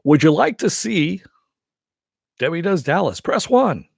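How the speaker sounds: hammed-up recorded phone menu; Movie Phone voice. Shown as a demonstration. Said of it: "a Movie Phone impersonation".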